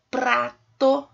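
"prato" split slowly into pra, to; sílaba por sílaba. Shown as a demonstration. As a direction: stretch "prato" into two separate syllables. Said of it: "basta separar em sílabas". ("prato" devagar, separado em pra e to)

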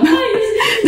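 sudden high-pitched burst of laughter; real high laugh. Real, not acted. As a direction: crack up high. Laughing at the video.

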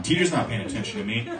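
ordinary talk, nothing marked

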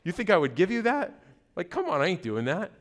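amused, under-the-breath mock incredulity; incredulous God. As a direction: play an exasperated God softly scoffing at a request. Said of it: "I just imagine God up there".

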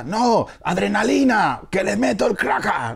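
high, pumped-up shouted cheer; playful exaggerated excitement; mock hype. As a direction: sell the rescue like a hype man. Hyping the series comeback.

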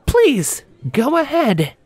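sarcastic colleague offering it up; high-pitched lady voice, mock polite; Christie's voice. Christie voiced by male reader.

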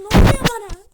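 loud anguished cry; character panics and cries out, loud anguish. Acted. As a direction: wail it as you lose control. Character losing control.

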